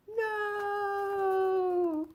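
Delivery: a high, far-off cry of dismay; shocked no. Acted. Macduff hears his family is dead, high shocked cry. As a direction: play the shocked one. Acting Macduff's reaction in text.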